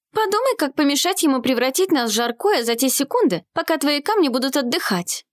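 put-on high-pitched girl's character voice; urgent young heroine. Girl instructing ally under magical shield, high urgent voice.

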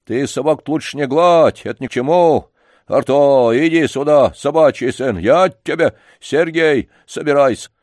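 put-on old man calling the dog and the boy to leave. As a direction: end the talk loudly. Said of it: "возвысил голос дедушка".

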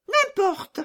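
very high, dismissive; brusque robber girl. Acted. Robber girl shrugging off Gerda's story, high and brusque. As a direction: toss it off in a squeaky bandit-child voice.

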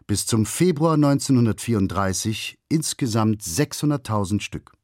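usual speaking voice

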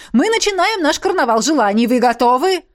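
loud, high call to the crowd; rousing announcer. A narrator's reading. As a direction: whip up the audience. Host rouses the crowd from stage. (громко заводя толпу)

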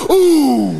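high hooting caveman oeh; warning grunt. Retold or imitated. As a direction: hoot a warning to the tribe. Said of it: "Dat we elkaar moesten waarschuwen van". (hoge waarschuwingskreet oeh)

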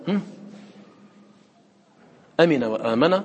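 ordinary talk, nothing marked

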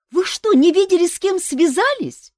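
high, loud scolding; indignant shout. A narrator's reading. Nymph scolds the tavern folk, high and loud. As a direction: berate them for their folly.

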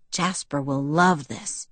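slow, low, wry mutter; muttered to herself. A narrator's reading. Bella mutters to herself smearing blood on rocks. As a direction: say it under your breath, dry and slow. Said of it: "I muttered to myself".

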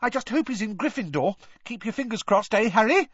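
high bouncing eager voice; eager boy hoping aloud. Acted. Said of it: "practically bouncing up and down".

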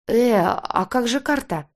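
confused, uncertain asking; bewildered. A narrator's reading. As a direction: drag the first sound out, at a loss. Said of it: "растерянно пробормотал помощник Софи".

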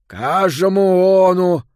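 loud, slow, mushy without lips; lipless mouth voice. A narrator's reading. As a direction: slur through a tiny mouth hole. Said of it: "обратился ко мне Перси".

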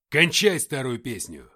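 loud, forceful, drawn-out rebuke; angry bark. A narrator's reading. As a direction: cut him off with a loud bark. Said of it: "рявкнул Игнат". (сердитый громкий рявк)

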